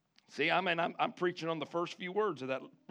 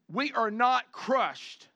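very slow, emphatic; 4 semitones higher, much slower than usual. Real, not acted. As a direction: stretch out "we are not crushed" with weight on "not". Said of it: "emphasis on verse".

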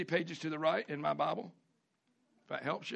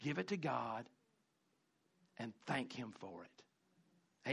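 much lower and softer, almost confiding; soft, earnest answer; 6 semitones lower, 10 dB quieter than usual. Acted. Pastor softly answering a complaining member, hushed and earnest.

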